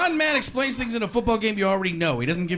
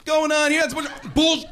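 indignant; 9 semitones higher, 5 dB louder than usual. Retold or imitated. Angry viewer at censored TV.

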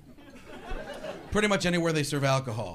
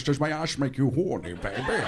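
lightly growled Connery come-on; Connery as Austin Powers; 5 semitones lower than usual. Shown as a demonstration. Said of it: "Quick impression, Sean Connery as Austin Powers".

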